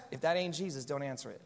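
low, soft playful aside; church quip. Pretend. Pastor quips to a ringing phone in church, low and playful. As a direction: say it like a knowing pastor's joke. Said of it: "I always wanted to say that".

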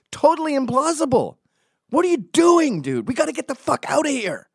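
frantic disbelief. Retold or imitated. Urging Tommy to escape the crowd, high frantic alarm. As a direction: panic at a reckless plan. Panicking at Tommy's pub plan.

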